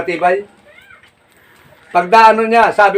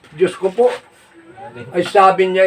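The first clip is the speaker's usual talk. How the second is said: ordinary talk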